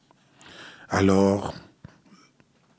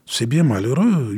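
ordinary delivery

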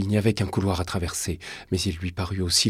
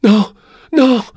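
very high, loud panicked shout; terrified cry; 14 dB louder than usual. Acted. Boy king waking from a nightmare, terrified cry. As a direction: cry out as if fending off danger. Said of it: "cria-t-il".

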